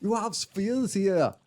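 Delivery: high, slow fake German; hammy German officer, slowly; German accent. Mock German raid voices.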